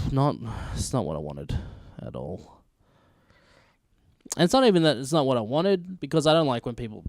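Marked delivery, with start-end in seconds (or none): 0.1-2.6 lower, sinking into quiet; deflated letdown. Real, not acted. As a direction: let the disappointment drop. Disappointed about Age of Ultron.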